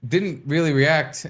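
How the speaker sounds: normal talking voice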